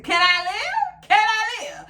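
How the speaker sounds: high, slow pleading exasperation; exasperated. Real, not acted. Vlogger begging her stalker to let her live, high and exasperated. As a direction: beg for some peace, exasperated. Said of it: "The, the girl couldn't live".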